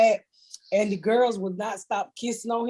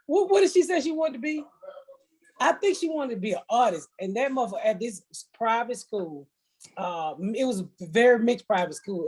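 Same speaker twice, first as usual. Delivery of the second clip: normal talking voice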